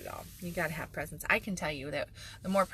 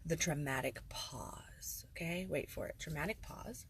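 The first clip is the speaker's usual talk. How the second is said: hushed build-up; 2 semitones lower, 10 dB quieter than usual. Real, not acted. Host pausing dramatically for coffee, murmured. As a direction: hold the suspense in a murmur. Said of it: "I'll put in the, like".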